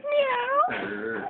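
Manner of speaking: put-on squeaky playful cat sound for the baby; high kitty meow. Shown as a demonstration. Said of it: "a kitty says".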